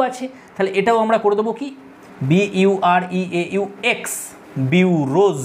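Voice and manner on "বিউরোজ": বিউরোজ split as BIU... ROZ; syllable-by-syllable demo. Shown as a demonstration. Teacher modelling the plural, বিউরোজ carefully broken up. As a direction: sound out বিউরোজ in parts, long o and buzzed z. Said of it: "এটা হয়ে যাবে বিউরোজ".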